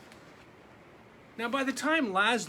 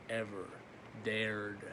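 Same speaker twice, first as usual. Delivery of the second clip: usual speaking voice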